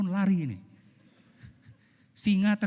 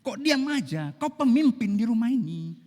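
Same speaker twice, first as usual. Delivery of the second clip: higher, louder scolding question; nagging wife; 7 semitones higher, 5 dB louder than usual. Acted. Wife scolding the husband who won't answer, raised voice.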